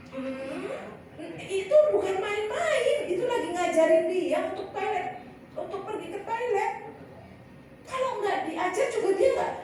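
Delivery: put-on mother amused at her toddler on the toilet, high laughing voice.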